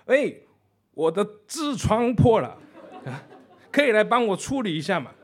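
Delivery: 把"瓷砖"说成"痔疮"的德国人，边笑边说; mispronounced foreigner. Retold or imitated. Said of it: "他不太会发音".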